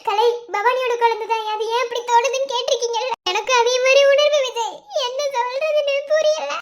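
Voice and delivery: distraught plea broken by sobs; crying hard. Acted. Wife asking her husband why motherhood was denied her, crying hard. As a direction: plead tearfully, catching sobs between phrases. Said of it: "இப்ப ஏன் இவ்வளவு அழுதுட்டு இருக்க".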